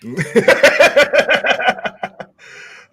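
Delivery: long, high, loud laughing fit; hearty laugh. Real, not acted. Host tickled by the guest's answer, laughing hard. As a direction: burst into loud, pealing laughter.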